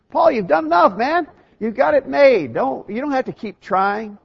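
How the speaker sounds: high, loud mock coaxing; mock urging. Voices someone urging Paul to quit.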